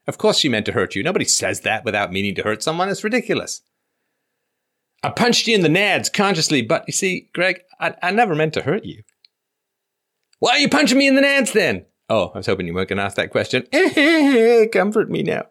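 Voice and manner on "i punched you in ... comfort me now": high, squeaky mock voices trading lines; mock dialogue. Host mocking girlfriend for caller in high voice. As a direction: ham up a silly sketch of the fight. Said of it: "host acts out mock dialogue".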